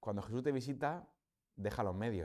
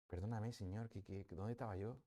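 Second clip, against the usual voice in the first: softly acted plea for forgiveness; penitent prayer; 7 dB quieter than usual. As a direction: ask pardon quietly, as if alone with God.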